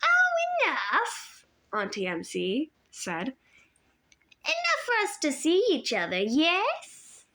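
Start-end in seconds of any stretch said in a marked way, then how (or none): 0.0-1.5 put-on high, sugary old woman's voice, a touch foreign; sweet old lady voice. A narrator's reading. Auntie Em's voice. 3.3-7.2 put-on high, sugary old woman's voice, a touch foreign; sweet old lady voice. A narrator's reading. Auntie Em speaking.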